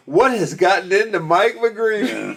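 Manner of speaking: slow, drawn-out announcer call; announcer impression, amused. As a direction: imitate an incredulous announcer, half laughing. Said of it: "you hear the announcer go".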